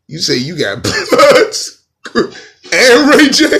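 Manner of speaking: slow, high, loud incredulous shout; mock disbelief. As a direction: rise in outrage at the claim. Incredulous reaction to threat.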